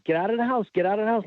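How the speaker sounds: retold frantic cry at half force; mock scream. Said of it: "So she's screaming".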